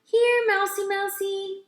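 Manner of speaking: high, sing-song call; calling a pet. A narrator's reading. Girl calling the lost mouse, sing-song. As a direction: coax the mouse out. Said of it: "called Lana".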